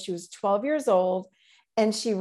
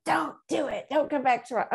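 put-on higher, pleading-defiant child's voice; feral girl refusing; 5 semitones higher than usual. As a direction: push her away like a cornered wild kid. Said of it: "And she's like".

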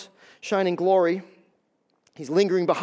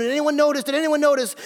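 anxious checking; 7 semitones higher, 5 dB louder than usual. Acted. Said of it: "as he's looking around going".

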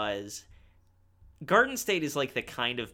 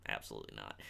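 even lower, quieter aside; muttered aside; 14 dB quieter, much faster than usual. Real, not acted. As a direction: sink the voice right down. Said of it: "repeated muttered aside".

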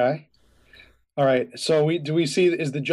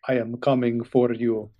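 Russian mobster; 4 semitones lower than usual. Acted. Payphone caller threatening the attorney, Russian mobster accent. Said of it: "Russian mobster character".

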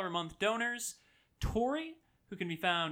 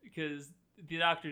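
normal talking voice

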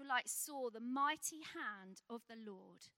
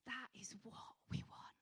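whisper; 5 semitones lower, 9 dB quieter than usual. Acted. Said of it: "whispered line after sermon build".

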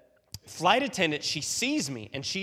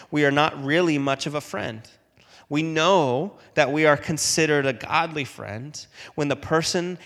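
earnest reading; 12 dB louder than usual. Acted. Reads a long quote aloud.

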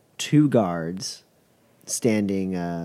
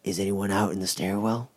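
whispered voice; 5 dB quieter than usual. Acted. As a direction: whisper it through the door. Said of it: "a very whispered voice going".

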